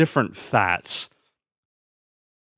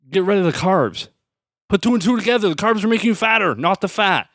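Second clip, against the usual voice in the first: ranting; 7 semitones higher than usual. Real, not acted. Ranting, pitch climbs high.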